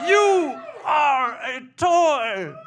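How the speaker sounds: slow, very high, loud outburst; toy-movie character shout. Acted. Misfit voice correcting a toy, slow high shout. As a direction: stretch each word into a high yell. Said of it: "voices that didn't fit anywhere".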